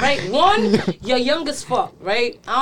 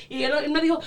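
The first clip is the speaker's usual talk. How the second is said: ordinary talk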